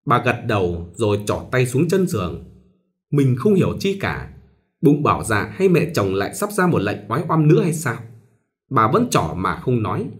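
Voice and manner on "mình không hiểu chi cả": ordinary delivery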